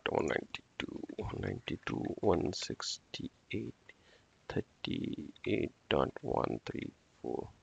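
near-whispered, reading digits under his breath; muttered to himself. Real, not acted. Instructor reading the new IP to himself, near-whispered. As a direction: murmur the numbers as you read them off the screen.

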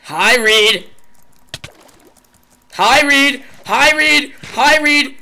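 loud, high, excited yelling; excited shouting. Real, not acted. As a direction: cheer on each hit. Excited hitting the cow.

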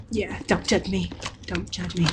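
low, soft repeated plea; sheepish aside. Real, not acted. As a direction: drop your voice and excuse yourself quietly. Sheepish aside about ripping parcels.

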